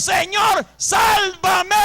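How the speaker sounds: high, loud shout for rescue; acted desperate cry. Preacher voicing sinking Peter calling to the Lord, desperate shout. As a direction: cry out to be saved, at full voice. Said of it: "y gritó y le dijo".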